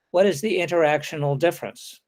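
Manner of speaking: ordinary delivery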